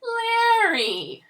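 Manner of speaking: very high, loud, drawn-out cry; theatrical long falling squeal; sudden call. A narrator's reading. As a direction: shriek the name like a delighted diva. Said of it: "she called out suddenly".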